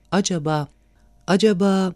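hesitant, halting repetition; unsure, searching for how to ask. A narrator's reading. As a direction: falter with a pause, lifting on the repeat. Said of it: "diye tereddütte kaldı".

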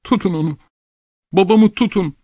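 very high, urgent cry; cried out. A narrator's reading. Daughter begs others to stop her father, crying out. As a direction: call for help, pitched right up. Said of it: "diye bağırdı Geronte".

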